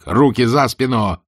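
high, loud, drawn-out command; feigned hysterical shout. A narrator's reading. Spark orders hands behind back, mock-hysterical shout. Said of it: "по-прежнему играя истерику".

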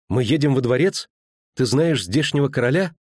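put-on high, lively girlish questions; astonished questioning. A narrator's reading. Girl learning they ride to a palace, astonished. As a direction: raise the pitch in eager surprise.

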